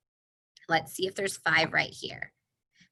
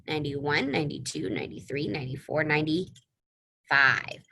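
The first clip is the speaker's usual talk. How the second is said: ordinary delivery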